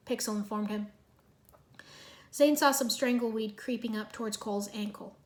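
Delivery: ordinary delivery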